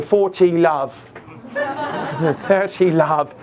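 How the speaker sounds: mock British tennis umpire; British accent. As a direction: call the score in a put-on British accent. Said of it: "you know, British accent. He's got".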